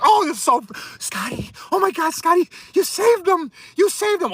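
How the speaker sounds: high-pitched, fawning fan impression; mock gushing. Imitating chat fans praising Scotty, mock gushing. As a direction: gush in a high, mocking swoon.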